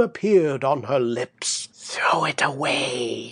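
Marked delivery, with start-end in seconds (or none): 1.7-3.3 hushed breathy witch whisper. Acted. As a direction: whisper it like a secret spell. Witch hands back flower, unvoiced.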